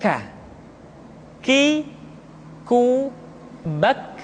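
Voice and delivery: "K", "Ki", "ku", "bak" with air released after k; breath-flowing k. Shown as a demonstration. Teacher demonstrating kaf, aspirated "bak". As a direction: say "K. Ki, ku, bak" with a puff of breath on every k. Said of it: "Diucapkan dengan mengalirkan nafas kita".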